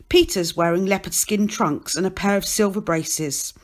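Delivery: usual speaking voice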